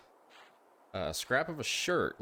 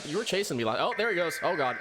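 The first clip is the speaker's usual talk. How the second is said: high, loud panic; fleeing in panic; being chased; 8 semitones higher, 3 dB louder, faster than usual. Real, not acted.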